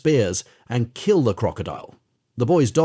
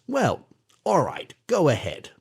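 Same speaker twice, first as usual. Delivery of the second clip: a hint of a sly crocodile giving in; grudging crocodile; 4 semitones higher than usual. Crocodile to the captive boy, lightly voiced grudging.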